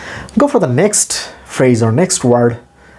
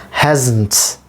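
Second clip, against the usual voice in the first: ordinary delivery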